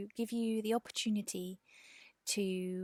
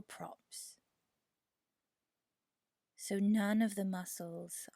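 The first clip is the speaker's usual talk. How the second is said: guided meditation. Real, not acted. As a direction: ease them into rest, unhurried and measured. Leads a yoga nidra meditation.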